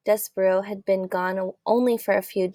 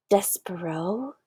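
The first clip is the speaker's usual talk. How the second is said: slow, softer stunned whisper of a name; 6 dB quieter than usual. A narrator's reading. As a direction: breathe the name in disbelief. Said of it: "he whispered".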